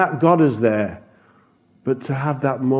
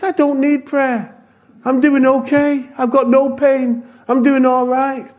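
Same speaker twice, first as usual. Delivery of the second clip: high, lively joyful declaration; joyful old man; 11 semitones higher than usual. Retold or imitated. Healed old man tells visitors he's fine, joyful and high. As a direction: beam with new health.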